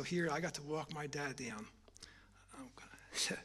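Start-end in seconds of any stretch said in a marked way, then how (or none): 2.6-3.4 overcome, barely voiced; a son overcome remembering his blind father; choked whisper. Real, not acted. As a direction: whisper it, too moved to speak. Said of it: "overcome, whispering".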